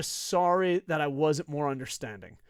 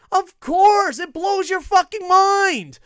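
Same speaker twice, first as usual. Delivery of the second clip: loud, much higher, slow mock rave; mock gushing; 14 semitones higher, 13 dB louder, slower than usual. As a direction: gush sarcastically like a starstruck fan.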